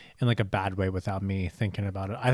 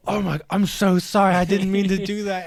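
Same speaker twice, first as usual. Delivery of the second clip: high, louder, mock-flustered sorry; flustered apology; 8 semitones higher, 7 dB louder than usual. Handsy friend apologizing after being threatened, high and loud. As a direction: play the sheepish friend caught out, pitched up and loud.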